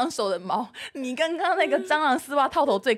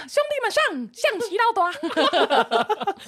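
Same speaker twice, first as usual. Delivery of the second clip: hammed-up rallying shout; 10 semitones higher, 5 dB louder, much slower than usual.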